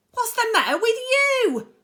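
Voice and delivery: high, loud screech; furious screeching aunt. A narrator's reading. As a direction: screech at the boy like a harpy. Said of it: "Aunt Spiker screeched".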